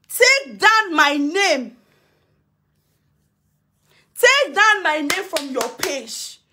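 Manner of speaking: each word spaced out and weighted; drawn-out ultimatum to Mama Nura; slow firm demand. Real, not acted. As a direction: spell out the order word by word. Demanding removal from her page.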